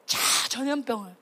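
ordinary talk, nothing marked

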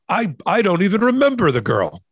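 mock the prince in a plummy British accent; quick plummy British accent impression. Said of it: "my plummy British accent, royal accent thing".

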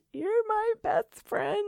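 high, slow sing-song cartoon line; cartoon quote voice. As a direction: coo it like a cartoon hero. She-Ra quote.

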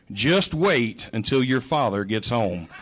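low, slow, ominous warning; mother's threat. Retold or imitated. Mother warning kids about dad coming home, low and slow. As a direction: lean into it like a looming promise.

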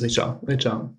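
"Richard" as ree-SHAR; native French pronunciation. Shown as a demonstration. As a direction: say "Richard" the French way, soft sh, silent d. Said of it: "how do you say your name".

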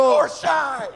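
high shouted echo; mock shout. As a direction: shout back the tag line. Echoing the shout.

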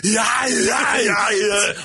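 a wrecked Schwarzenegger grunt; mangled Arnold. Pretend. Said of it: "Arnold Schwarzenegger".

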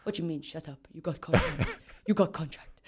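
lightly voiced brusque coach, amused; a hint of the gruff coach breaking the news; Bobby impersonation. Coach Bobby telling her she got a contract, lightly imitated. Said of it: "You do a brilliant Bobby impersonation".

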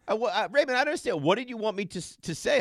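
high-pitched incredulous protest; exasperated. Real, not acted. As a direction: plead your case, baffled. Host defends himself to colleagues.

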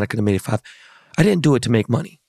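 usual speaking voice

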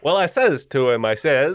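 a bad Dracula accent slipping into Italian; mock Transylvanian accent. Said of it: "now I sound like an Italian, soccer fan".

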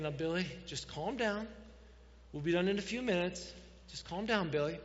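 ordinary talk

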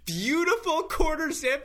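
high, loud, hammed-up envy; comic covetous raving; jealous gushing. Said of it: "the extreme jealousy I had in that moment".

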